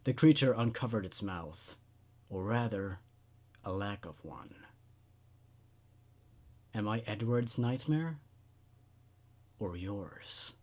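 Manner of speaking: low, hushed horror-story reading; quiet spooky narration. A narrator's reading. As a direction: read it like a creepy tale told at night. Reads a horror story aloud.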